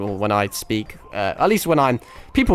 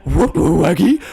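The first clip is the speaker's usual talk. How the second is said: loud, higher cartoon dog voice; Scooby-Doo; 4 semitones higher, 6 dB louder than usual. Streamer attempting a cartoon impression for viewers, goofy dog voice. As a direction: put on a goofy talking-dog voice. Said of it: "a Scooby-Doo impression".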